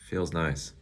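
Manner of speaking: ordinary delivery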